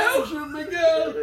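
high-pitched fake sob, laughing; cry-laugh the line; mock crying. Other host repeats crying line.

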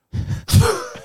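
airy squeal of laughter; high breathy laugh. Real, not acted. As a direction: burst out laughing. Laughing after the quote.

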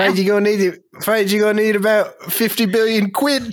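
put-on cheeky British street accent; mock British accent. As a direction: break bad news like a British lad. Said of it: "to British credit cards".

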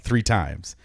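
usual speaking voice

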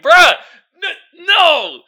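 loud, high-pitched refusal; emphatic exasperated outburst. Real, not acted. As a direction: burst out in high, loud protest. Exasperated after the impression.

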